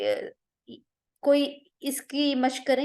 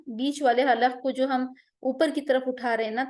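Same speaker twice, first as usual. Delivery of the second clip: ordinary delivery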